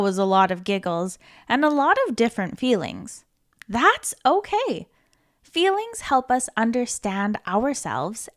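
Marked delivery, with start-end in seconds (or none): none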